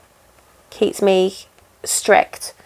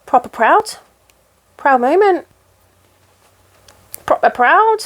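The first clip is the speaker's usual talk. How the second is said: badly faked Yorkshire, pitched up; bad Yorkshire accent; 6 semitones higher than usual. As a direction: put on a hammy, high Yorkshire accent. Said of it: "that was a really bad Yorkshire accent".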